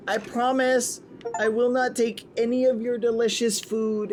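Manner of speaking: pleading orphan voice. Acted. Begging as orphan.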